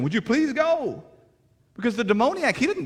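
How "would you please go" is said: high, slow "please" as the townspeople plead; lightly voiced timid plea for Jesus to leave; a hint of frightened townsfolk begging.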